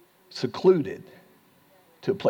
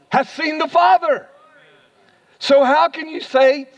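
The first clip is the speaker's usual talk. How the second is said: preaching at full force; 8 semitones higher, 5 dB louder than usual. Acted. Pastor preaching Jesus' words to the church, at full force. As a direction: raise the voice high and preach it out.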